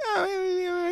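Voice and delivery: squeaky high brush-off; mock dismissive dad. Dad brushing off his son, squeaky mock voice. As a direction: wave him off in a silly high voice.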